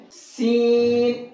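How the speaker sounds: seen drawn out slowly; letter seen demonstrated. Shown as a demonstration. Teacher drawing out the letter seen for students. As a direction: stretch the letter seen as a model sound. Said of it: "اس سین کو اس طرح سے پڑھنا ہے".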